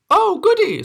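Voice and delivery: very high, quavery granny; kindly old granny. Acted.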